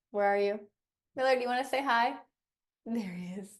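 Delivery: light singsong pet voice; talking to the dog. Real, not acted. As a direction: coax the dog to say hi.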